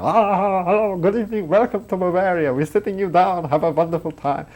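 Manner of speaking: German accent, pitched high and loud, a big fake welcome; hammed-up German waiter. German accent of a waiter welcoming gullible American tourists, hammed up. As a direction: lay on the German accent like an over-eager waiter. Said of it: "giving them all the sort of full German bit".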